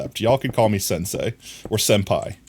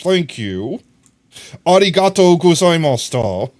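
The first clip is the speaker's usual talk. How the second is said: louder, higher ironic thanks; loud mock Japanese thanks; 6 semitones higher, 6 dB louder than usual. Ironically thanking chat in Japanese, loud and high. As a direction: bark out the thanks for a joke.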